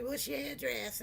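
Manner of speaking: soft, lightly imitated kid voice; little kid caller. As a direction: quote the young caller softly, barely childlike. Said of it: "sound like a little kid".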